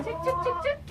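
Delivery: calling chickens. Real, not acted.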